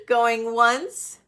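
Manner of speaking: ordinary talk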